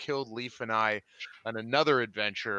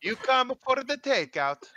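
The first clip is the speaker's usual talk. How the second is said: mock Italian; 12 semitones higher, 3 dB louder than usual. Joking as Stromboli.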